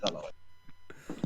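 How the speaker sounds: usual speaking voice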